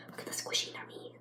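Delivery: soft unvoiced whisper. Real, not acted. Telling viewers to look at the squishy, whispered. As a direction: hush them toward the squishy.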